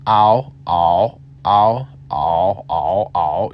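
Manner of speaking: "ow" rounded as much as possible; "ow" opened and rounded fully. Shown as a demonstration. Teacher contrasting rounded "ow" sounds for learners. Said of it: "open and round the sound".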